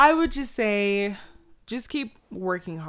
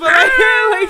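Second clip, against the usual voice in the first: excited shout; 15 dB louder than usual. Real, not acted. Excited shouting mid laugh.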